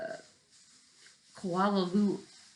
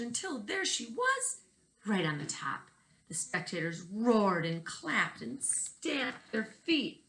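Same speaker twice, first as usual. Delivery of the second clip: higher and faster, building to the top; rising excitement; 5 semitones higher than usual. Acted. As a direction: race it upward with thrill. Race climax in story.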